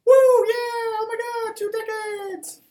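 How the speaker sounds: screaming with joy, very high; excited cheering. Real, not acted. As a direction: cheer like a birthday party. Host turning twenty celebration.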